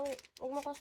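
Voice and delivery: ordinary delivery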